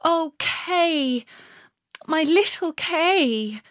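high, slow, mild sob; a lightly voiced tearful longing; sobbing Gerda. A narrator's reading. Gerda sobs for lost Kay, high and slow. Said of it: "sobbed Gerda".

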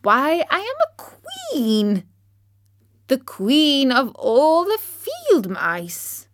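high, slow, haughty squeak; proud mouse queen. Acted. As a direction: draw herself up royally. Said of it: "cried the little animal indignantly".

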